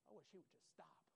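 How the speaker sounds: unvoiced grumbling whisper. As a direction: mutter it under your breath. Listener grumbling about the preacher.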